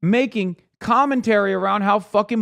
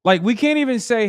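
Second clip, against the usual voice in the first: ordinary talk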